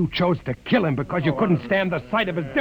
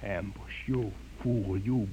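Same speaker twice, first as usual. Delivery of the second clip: bitter contempt; 9 semitones lower, 7 dB quieter, slower than usual. Acted.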